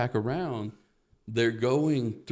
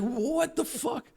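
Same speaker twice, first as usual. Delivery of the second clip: squeaky stunned disbelief; 4 dB quieter, much slower than usual. Retold or imitated. Stunned at "chocolate fentanyl" joke.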